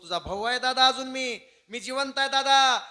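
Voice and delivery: high, loud pledge to a brother; impassioned vow. Acted. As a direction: cry it out with fierce devotion. Brother vows to save him.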